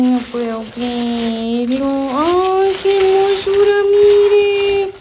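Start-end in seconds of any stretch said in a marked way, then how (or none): 2.1-4.9 high, loud gush of delight; thrilled gift-opening exclamation; delighted squeal. Real, not acted. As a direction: squeal over a lovely gift.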